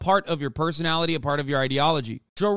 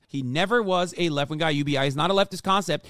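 normal talking voice

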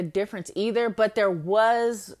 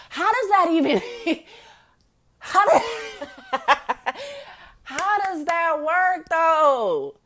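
high, loud voice breaking into laughter; real incredulous laughing; laughing disbelief; 9 semitones higher, 6 dB louder, slower than usual. Real, not acted. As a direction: crack up while trying to ask. Cracking up in disbelief.

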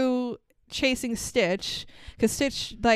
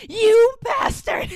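high, loud, drawn-out mock fury; hammed-up outraged yell, stretched slowly; intense anger; 13 semitones higher, 7 dB louder, slower than usual. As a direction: imitate an enraged little girl. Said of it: "intense anger for such a little girl".

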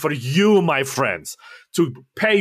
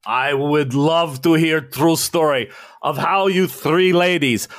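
Russian official; 4 semitones higher than usual. Acted. Russian official praising the visitors' stolen plane. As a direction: gush over the plane like a proud commissar.

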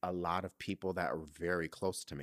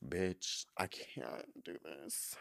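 breaking down; 3 semitones lower, 9 dB quieter, much slower than usual. Real, not acted. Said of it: "I'm really about to start crying".

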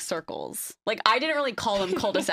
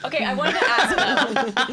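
laughing through it; 4 semitones higher, 6 dB louder than usual. Real, not acted. Laughing while changing topic.

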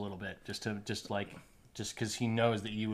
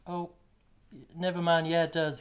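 higher, louder squeaky gnome voice; a silly doubtful little gnome, pitched up; Blumpkin the gnome; 8 semitones higher, 5 dB louder than usual. Acted. Blumpkin the gnome doubting the math, squeaky and high.

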